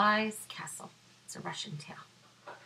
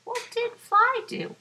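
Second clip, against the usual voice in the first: excited question; 9 dB louder than usual. Acted. Asks listeners.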